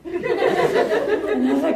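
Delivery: slow laughing words. Real, not acted. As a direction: stretch it out, laughing. Laughing mid-story.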